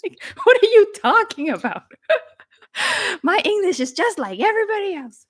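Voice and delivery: put-on retelling being told her accent made sense, laughing disbelief. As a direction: play your younger self, baffled and giggling. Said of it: "I was like".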